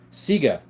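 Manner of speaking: Sieger said as "SEE-guh"; own pronunciation. Shown as a demonstration. Streamer answering a viewer's request, Sieger as "SEE-guh". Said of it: "Sieger is how I say it".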